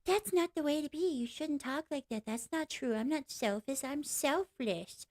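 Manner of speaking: sing-song mocking impression; mocking critics. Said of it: "Everybody's like".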